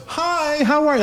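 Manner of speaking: high-pitched welcome, as if guests were expected; welcoming greeting. Retold or imitated. Wife greets a stranger walking in, high-pitched welcoming greeting. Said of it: "As if, like, we're expecting people".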